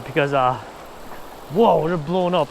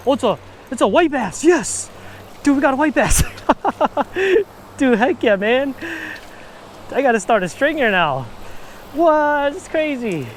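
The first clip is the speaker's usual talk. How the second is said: too excited; 7 semitones higher than usual. Real, not acted. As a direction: whoop it up like a fish just hit the line. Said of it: "I got a little too excited there".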